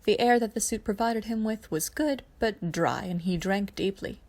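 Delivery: ordinary delivery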